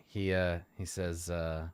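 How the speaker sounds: ordinary talk, nothing marked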